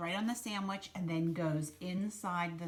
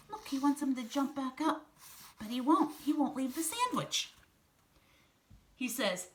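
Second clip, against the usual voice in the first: plain reading voice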